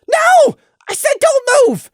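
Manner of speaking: very high, loud frantic yelling; very high, panicked shouting; desperate shout. Acted. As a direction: yell to keep a friend still. Prey yelling to wounded Gloom.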